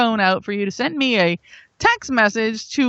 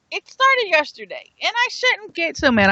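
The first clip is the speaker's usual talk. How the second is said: ordinary delivery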